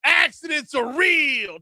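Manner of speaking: mock ad shout. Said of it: "over the top".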